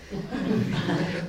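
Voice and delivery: a quiet, short chuckle; soft laugh. A narrator's reading. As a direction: chuckle softly to yourself. Narrator laughs.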